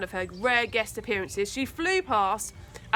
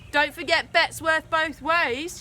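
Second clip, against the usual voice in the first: higher, louder, slow defiant shout; 6 semitones higher, 5 dB louder than usual. A narrator's reading. Tina shouting at Charlie over the bet, higher and louder. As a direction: shout it as a warning. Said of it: "she shouted".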